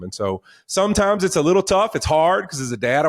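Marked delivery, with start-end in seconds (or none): none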